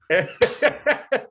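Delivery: high, loud burst of helpless laughter; laughing fit. Real, not acted. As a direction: crack up uncontrollably. Uncle laughing at crying nephew.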